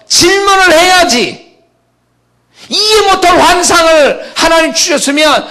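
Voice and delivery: high, loud, impassioned sermon cry; fervent preaching. Acted. Pastor urging the congregation to ask God, in a high, loud preaching cry. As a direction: let it ring out like a revival preacher.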